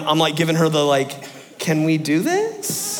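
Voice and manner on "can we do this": slow question sliding up high; tentative plea. Retold or imitated. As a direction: ask hopefully, voice rising high at the end. Pleading look at the teacher.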